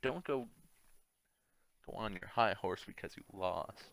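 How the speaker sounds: ordinary talk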